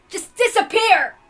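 high, loud, slow cry of rage; furious shout. Acted. Balor ordering the illusion gone, shouting furiously. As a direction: hurl it away with everything.

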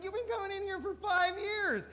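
hammed-up offended barman; mock angry bartender. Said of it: "he got really mad".

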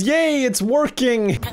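ordinary talk, nothing marked

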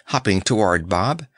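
usual speaking voice

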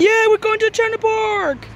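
high, slow cheer; excited. Real, not acted. Cheering about going to park.

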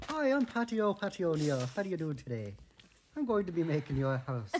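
loud, high, hammed-up Irish brogue; hammed-up Irish accent. Dad showing his son a real Irish accent, loud, high and hammy. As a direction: ham up a jolly Irish builder greeting the viewers. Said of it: "This is an Irish accent".

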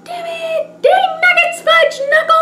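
high-pitched frustrated outburst; frustrated squeal. Real, not acted. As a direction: squeak out a cartoon curse. Battery trouble, frustrated outburst.

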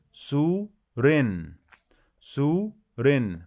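سو رن slow, high, split into two beats; teacher drills the syllables. Shown as a demonstration. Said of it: "مد بالواو".

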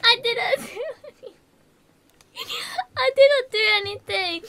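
high, loud, tearful protest; frightened protest. Real, not acted. Streamer protesting to the scary game, frightened protest. Protests after a jump scare.

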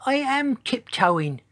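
very high, slow little voice; tiny voice. A narrator's reading. Giant dinosaur answers cross Triceratops, tiny-voiced. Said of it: "said Sizo in his tiny voice".

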